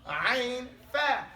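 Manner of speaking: ordinary talk, nothing marked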